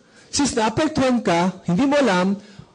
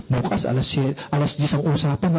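ordinary talk

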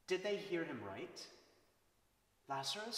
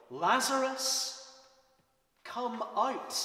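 slow, much louder and higher commanding yell; mighty roar; 10 semitones higher, 12 dB louder, slower than usual. A narrator's reading. Jesus calling into the tomb with a lion's roar. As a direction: roar his name like a lion. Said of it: "he yelled like a lion's mighty roar".

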